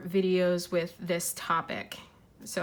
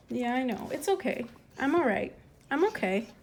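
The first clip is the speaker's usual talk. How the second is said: higher, wavering voice, holding back tears; talking yourself through a lump in the throat; getting emotional; 4 semitones higher than usual. Real, not acted. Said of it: "Harrison knows I'm getting emotional".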